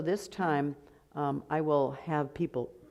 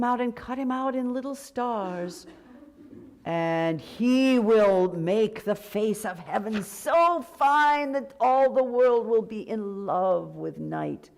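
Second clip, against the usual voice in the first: poem reading; 4 semitones higher, slower than usual. Acted. Reads lyric text slowly.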